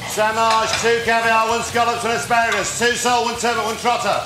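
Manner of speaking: high, loud kitchen call; calling out orders. Real, not acted. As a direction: bark the orders across the pass.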